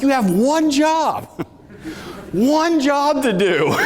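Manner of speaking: slow, high, drawn-out exasperated yell. Retold or imitated. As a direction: spell it out to an incompetent worker. Losing my mind at ramp agent.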